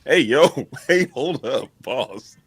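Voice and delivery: mock New Jersey guy, loud opening that settles down; New Jersey accent. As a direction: call out like a put-on Jersey guy. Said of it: "I got a New Jersey guy in me".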